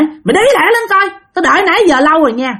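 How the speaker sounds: high, hurried nagging; impatient hurrying. Retold or imitated. Grandmother who has waited too long, impatiently urging. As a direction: nag someone who keeps you waiting.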